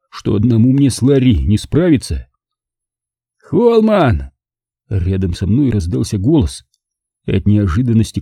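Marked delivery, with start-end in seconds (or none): 3.4-4.3 higher, calling a name out of nowhere; sudden call. A narrator's reading. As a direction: Pete suddenly calls to Holman, sharp call. Sudden call from beside him.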